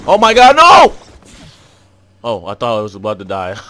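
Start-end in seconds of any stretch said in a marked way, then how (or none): 0.1-0.9 high, loud panicked scream. Real, not acted. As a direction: shriek as if about to die. Sudden near-death panic in game.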